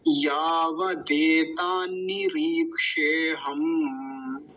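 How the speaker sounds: "यावदेतान्नी रीक्षे हम" very slow, every long vowel stretched; whole word joined. Shown as a demonstration. Teacher shows how the long word forms, very slow linked syllables. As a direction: chant out "यावदेतान्नी रीक्षे हम" linked, final m held. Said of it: "कैसे बनेगा?".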